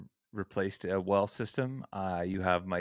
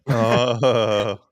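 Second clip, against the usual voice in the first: delighted; 3 semitones higher, 10 dB louder than usual. Real, not acted. Delighted by kid's cameo.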